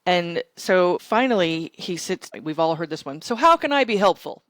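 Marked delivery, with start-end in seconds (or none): 3.2-4.4 louder, higher mimicry of a stock offer; mocking imitation, raised voice; mimicked stock line. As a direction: parrot the well-worn line, pitched up and loud.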